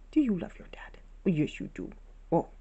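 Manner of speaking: whispered pet talk; breathy whisper to a pet; whispered cooing. Real, not acted. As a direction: whisper sweetly to the gerbil.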